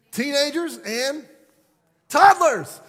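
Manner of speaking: rising exclamation, ending in a yell; shouted answer. Real, not acted. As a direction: build to a shout on toddlers.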